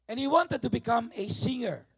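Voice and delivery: normal talking voice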